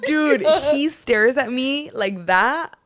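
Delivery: loud, high, drawn-out disbelief; incredulous exclamation. Real, not acted. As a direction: stretch it out, high and loud, amazed. Incredulous reaction to the stare.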